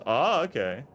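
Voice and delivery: ordinary delivery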